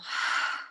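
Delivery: voiceless audible sigh; releasing exhale. Shown as a demonstration. Coach modelling release of feelings for listeners, releasing exhale. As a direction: let it all go on the out-breath. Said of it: "releasing upon the exhale".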